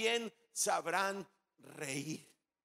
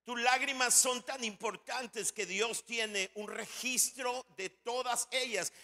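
usual speaking voice

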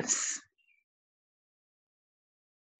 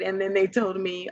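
normal talking voice

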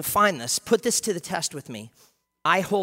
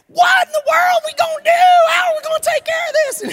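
high frantic shrieking panic, fast; frantic Pastor Caitlin; 15 dB louder than usual. Retold or imitated. Pastor mocking a frantic colleague, panicked shriek. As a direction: shriek it like she's losing it. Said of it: "we all in Pastor Caitlin".

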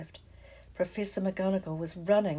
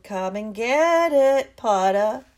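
taunting call; 3 semitones higher, 11 dB louder, slower than usual. Acted. Malfoy taunts Harry from the treetop, loud and slow. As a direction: dare him from the treetop. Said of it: "he called".

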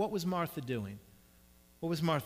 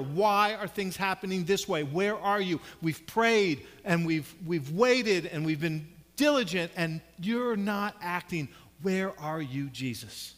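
loud, raised-pitch demand of God; fist-shaking frustration; 4 semitones higher, 7 dB louder than usual. Acted. Believer who prayed and waited questions God, frustrated and loud. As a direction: plead angrily, leaning hard on the words. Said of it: "shake your fist at God".

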